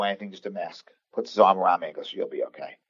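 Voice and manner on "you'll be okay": soft, quiet comfort; gentle reassurance. Retold or imitated. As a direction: reassure him softly. Heart doctor comforting the scared patient.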